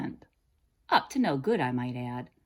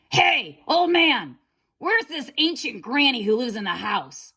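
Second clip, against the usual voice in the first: loud, high impatient yell; impatient shout; 6 semitones higher, 6 dB louder than usual. A narrator's reading. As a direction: shout at the deaf farmer impatiently. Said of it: "Impatiently he shouted".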